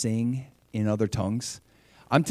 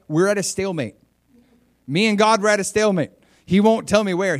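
higher, louder retold complaint; mock exasperation; 7 semitones higher, 5 dB louder than usual. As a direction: act out her frustration, pitched up. Retold missionary complains of stalemate.

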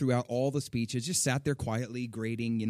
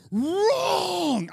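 sudden piercing scream, much higher and louder; 10 dB louder than usual. Retold or imitated. Teacher screaming at a student's answer, high and loud. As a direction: shriek the word at the student. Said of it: "I mean, screamed it".

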